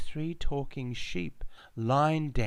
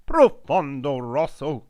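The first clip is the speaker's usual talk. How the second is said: loud, higher, rolled Italian flourish; Italian phrase; 5 semitones higher, 9 dB louder than usual. Acted. Man beside the bull, in loud Italian. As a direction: declare it like a proud Italian.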